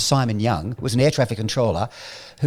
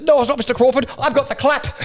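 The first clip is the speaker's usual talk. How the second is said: much higher, indignant shout; retold outburst; 12 semitones higher than usual. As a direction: yell it at the boss in outrage. Furious actor confronts the boss.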